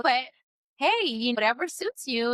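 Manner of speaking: ordinary delivery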